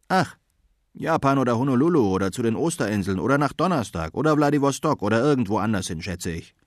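quick, offhand rattled-off list; breezy sea dog. Acted. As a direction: toss off the places carelessly. (lässiger Meerhund, schnell)

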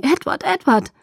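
high frightened calling of his name; panicked cry to a collapsing partner; horrified cry. Acted. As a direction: call his name in terror. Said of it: "Entsetzt schrie ich auf".

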